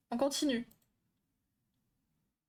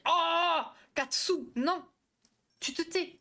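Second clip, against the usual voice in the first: loud, high outburst; exclaimed telling-off; scolding; 6 semitones higher, 4 dB louder, slower than usual. Real, not acted. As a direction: cut someone off with an indignant cry. Playfully scolding a chat member.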